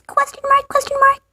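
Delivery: tiny, very high squeak, softly; comically unsure. Pretend. Host doubts their own claim about K O Sers, comically unsure. Voices punctuation after doubtful guess.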